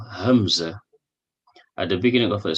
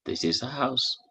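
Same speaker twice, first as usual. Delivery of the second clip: ordinary talk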